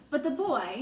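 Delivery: plain reading voice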